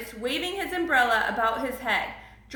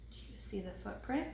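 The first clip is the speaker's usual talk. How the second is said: ordinary delivery, nothing marked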